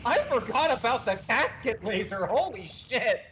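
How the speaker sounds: excited alarm. Real, not acted. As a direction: blurt it out in shock. Alarmed reaction to game event.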